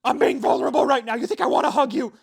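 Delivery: hammed-up raging addict yelling at the new guy; furious shout. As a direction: bellow it like a man demanding a hug by force. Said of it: "he grabs him by the neck and he's like".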